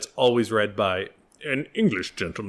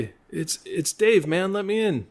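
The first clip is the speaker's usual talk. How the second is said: much higher calling voice; 9 semitones higher than usual. Pretend. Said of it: "[name]'s Not Here".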